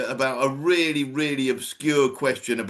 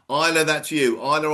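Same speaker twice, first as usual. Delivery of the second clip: ordinary delivery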